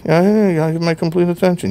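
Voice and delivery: fast, dismissive mumble; mumbled brush-off. Shown as a demonstration. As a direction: rattle it off without really listening. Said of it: "You're mumbling".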